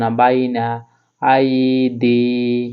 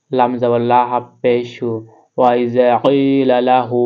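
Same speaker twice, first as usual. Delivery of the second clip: ordinary talk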